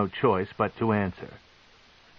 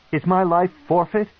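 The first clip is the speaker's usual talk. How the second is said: high, loud frightened question; fearful plea; 9 semitones higher, 5 dB louder than usual. A narrator's reading. Alton begs the matron to know his fate. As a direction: ask it scared for your life.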